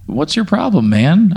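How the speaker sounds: high, hurt and indignant. Pretend. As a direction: act wounded and offended. Player character feels betrayed.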